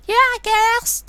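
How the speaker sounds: put-on terrible accent in high falsetto; hammed-up, very high mock accent; Martha.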